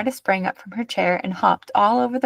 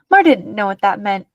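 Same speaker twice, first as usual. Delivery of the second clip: ordinary delivery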